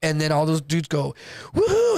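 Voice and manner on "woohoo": high, loud victory whoop; whooping cheer. Retold or imitated. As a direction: cheer like you just won the cell. Attackers cheering after taking cell.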